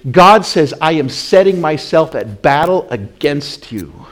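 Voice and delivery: thundering sermon warning; preaching at full force. Acted. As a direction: lean hard on every word of the warning. Preacher stresses God's battle.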